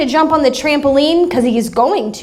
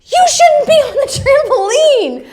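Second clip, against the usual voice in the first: retold yell; 11 semitones higher than usual. Husband Tom yells at neighbor kid, high mock yell. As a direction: shout the kid off the trampoline, half laughing. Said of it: "Tom yells out".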